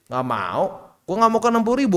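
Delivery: the personified market sulkily refusing to drop; market as a stubborn brat. Pretend. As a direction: pout a playful refusal in the market's voice. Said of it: "kata B T C".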